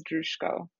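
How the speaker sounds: "Drushko" with sh before the k; surname pronunciation. Shown as a demonstration. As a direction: demonstrate the family's way of saying "Drushko". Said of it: "So we pronounce it as".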